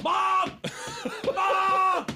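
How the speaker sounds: put-on high, loud childish shout; calling like a child. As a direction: yell for mom like a kid. Child calling mother upstairs.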